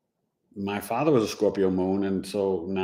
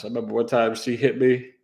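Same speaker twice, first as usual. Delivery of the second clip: ordinary talk, nothing marked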